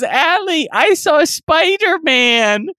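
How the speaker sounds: put-on high, slow little boy voice; little brother calling up from the sewer; kid voice. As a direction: tell it wide-eyed like a small kid.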